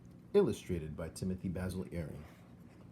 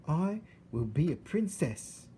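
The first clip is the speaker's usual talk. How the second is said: put-on high, slow, earnest girl; Mig's wish; 8 semitones higher than usual. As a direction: tell your deepest dream slowly.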